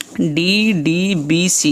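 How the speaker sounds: "D D B C" read letter by letter, very slow and spaced; slow dictation. Shown as a demonstration. Teacher dictating answers to students, very slow and spaced. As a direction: spell out "D D B C" slowly so students can note it. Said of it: "ये correct answers हैं।".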